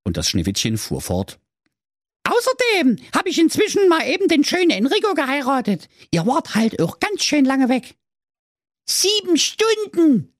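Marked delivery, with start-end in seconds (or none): 2.2-8.0 very high, fast offhand princess. Acted. 8.9-10.3 very high, slow reproachful dwarf. Acted. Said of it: "sagte der Justin vorwurfsvoll".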